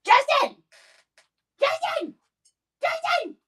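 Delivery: loud high shout across the house; calling out. Real, not acted. Shouting to Justin in another room to come help, loud call. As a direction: yell for him from another room.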